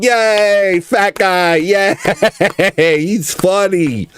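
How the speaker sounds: a silly crowd cheering the fat guy; mock cheering crowd. Mocking how everybody cheers the fat guy, high and giggly. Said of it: "everybody's like".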